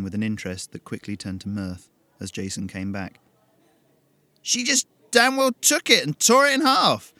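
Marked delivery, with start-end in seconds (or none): 4.4-7.1 much higher, louder outraged voice; outraged young man; indignant rant. Acted. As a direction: spit it out in disbelief. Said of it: "He scowled".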